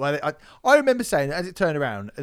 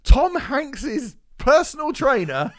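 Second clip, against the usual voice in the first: slow, higher, louder hammed-up host announcement; mock presenter; 9 semitones higher, 5 dB louder, slower than usual. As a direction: drag out each name like a cheesy host. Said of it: "It was like, what?".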